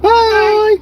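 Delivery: high, loud singsong bye-bye; chirpy cartoon farewell; squeaky goodbye. Real, not acted. Cheery goodbye to viewers.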